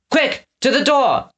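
high, urgent cry; cried out. Acted. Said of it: "Eggs cried".

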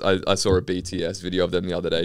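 ordinary talk

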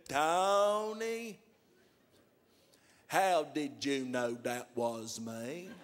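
very slow, drawn-out caller; slow-talking John on the phone; John's slow talk. Retold or imitated. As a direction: stretch every word like a slow talker. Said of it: "you just got one of them voices".

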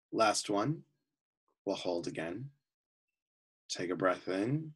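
calm, unhurried voice with long pauses between phrases; guided meditation. Real, not acted. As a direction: guide them gently into relaxation, often softening at phrase ends.

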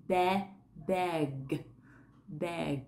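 "bag" said as beg, mouth barely open; beg demo. Shown as a demonstration. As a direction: ease from "ba" into "bag" with short e. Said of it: "là âm e".

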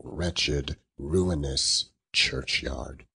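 ordinary delivery

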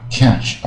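"catch" with a wide open "a"; teacher's careful open-vowel "catch"; curled-tongue model. Shown as a demonstration. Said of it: "也是很卷舌啊".

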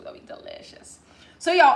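ordinary delivery

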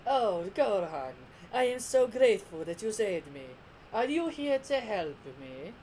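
mock-grateful villain voice, vowels stretched; Frieza. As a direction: ooze false thanks, drawling each phrase. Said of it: "I'm doing that voice, it's because that's his English dub voice".

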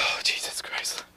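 slow nervous whisper. Real, not acted. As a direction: whisper it, bracing yourself. About to hold the bug.

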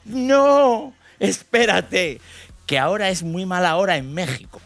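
put-on high, slow teenage daughter voice; daughter's voice. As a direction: mimic the daughter, drawn out and high. Hija voiced in anecdote.

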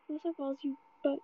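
ordinary delivery

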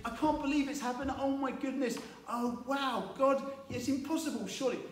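high, quick gasp of disbelief; a hammed-up oh-my-goodness; mock disbelief. Zechariah's words he didn't say, high mock amazement. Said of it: "he didn't speak".

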